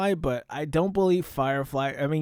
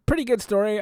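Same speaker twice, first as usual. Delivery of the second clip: ordinary talk, nothing marked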